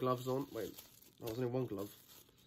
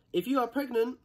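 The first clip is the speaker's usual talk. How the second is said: mock warning voice; 10 semitones higher, 7 dB louder than usual. Reading warning label mock-dramatic.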